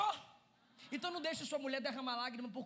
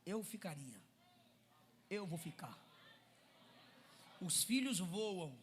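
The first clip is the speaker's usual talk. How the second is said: lower, softer teasing tone; smug husband; 5 semitones lower, 7 dB quieter, slower than usual. Acted. Husband teasing his wife that he stays, soft and low. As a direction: tease her knowingly, low and soft. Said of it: "O que ele tá dizendo é".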